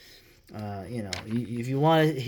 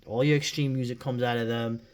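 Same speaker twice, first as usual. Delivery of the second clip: ordinary talk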